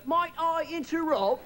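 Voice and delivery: slow, high, over-polite British request; apologetic British driver. Retold or imitated. Driver breaks a long silence, slow high polite British voice. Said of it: "he would apologize three times before he spoke".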